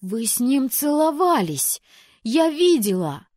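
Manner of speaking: high, loud, slow accusing cry; furious jealous outburst; jealous accusation. Acted. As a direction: shriek it at your sister. Jealous sister accuses loudly.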